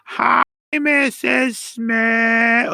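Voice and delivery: slow, sing-song, high chorus of schoolkids; class greeting in unison. Retold or imitated. Schoolkids greeting a visitor, slow sing-song chorus. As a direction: drag it out like a whole class reciting. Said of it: "why do we all do that".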